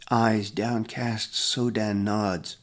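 usual speaking voice

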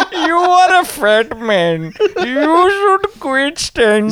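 high, slow, hammed-up Indian accent; exaggerated Indian-accented scolding. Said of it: "you're doing a voice".

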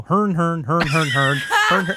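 laughing nasal hern noises as foreigners mimicking Americans; hammed-up hern hern chant, half laughing; mock American gibberish. Friend quotes foreigners mocking Americans, laughing mock gibberish. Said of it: "people in foreign countries will just go".

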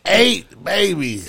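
slow drawn-out disbelief. Real, not acted. Reading ad in disbelief.

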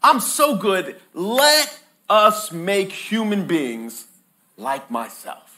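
high, slow, grand boast; God admiring his own goodness; a lightly hammed-up proud creator. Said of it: "So God says".

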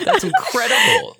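squeaky laugh through the words. Real, not acted. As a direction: laugh it out, amazed. Amazed by an estimated timeline.

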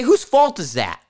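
usual speaking voice

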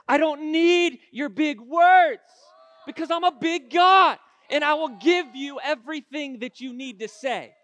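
loud preacher's shout as God; full-force preaching. Acted. God answers the one without big words, shouted preaching. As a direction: thunder God's answer at the doubter.

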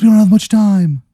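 a glowing dummy hurrying the hero along; glowing dummy's urgent warning. Acted. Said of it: "He goes".

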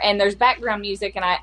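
ordinary delivery

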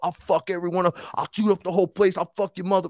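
high-pitched ranting threats; mock going nuts. Mocking the gangster's street threats, hammed-up crazed ranting. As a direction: imitate a wild street tough ranting. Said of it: "He was going nuts".

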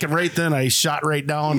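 ordinary talk, nothing marked

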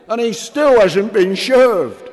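loud, slow, high jeer; drunken shout. Acted. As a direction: bellow it mockingly. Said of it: "shouted a drunk".

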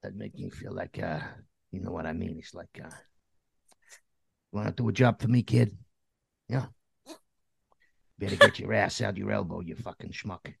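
lightly voiced New Jersey wiseguy, softening at the ends; New Jersey mobster. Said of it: "my, um, New Jersey mobster voice".

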